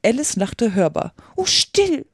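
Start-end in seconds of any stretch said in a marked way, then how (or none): 1.3-2.0 very high, alarmed rabbit hushing; frightened. A narrator's reading. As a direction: hush her in high-pitched alarm. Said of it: "flüsterte das Kaninchen in sehr erschreckendem Tone". (erschrocken, mit sehr hoher Stimme)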